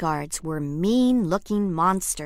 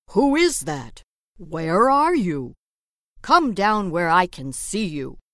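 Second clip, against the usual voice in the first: louder searching call; Wolf calling, louder; 6 dB louder than usual. Acted. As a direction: call into the dark room.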